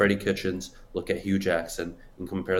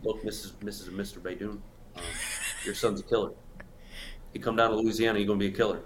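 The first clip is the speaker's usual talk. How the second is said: lightly imitated coach, hushed and halting; Ed Orgeron impression; 7 dB quieter, faster than usual. Imitating a coach pitching parents at the dinner table, hushed. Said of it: "Ed Orgeron would have scared my parents away".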